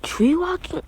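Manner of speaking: high, mocking question; scoffing surprise. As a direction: toss it off as if it's too easy. Naruto scoffs at easy training.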